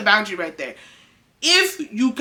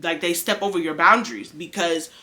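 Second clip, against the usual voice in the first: usual speaking voice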